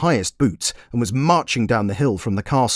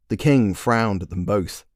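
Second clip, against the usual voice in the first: plain reading voice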